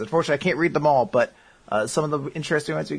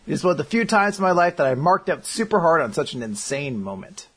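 normal talking voice

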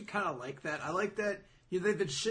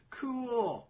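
long-drawn, higher "cool" sliding down; underwhelmed reaction; 6 semitones higher than usual. Acted. Reacting to a manga ending, mock-impressed. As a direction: stretch it out like a mock-impressed viewer.

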